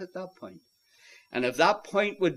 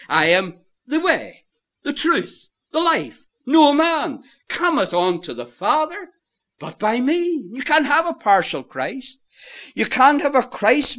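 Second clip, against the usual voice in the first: 1 fervent preaching cry; 8 semitones higher, 5 dB louder than usual. Real, not acted. Fervent peak of the sermon.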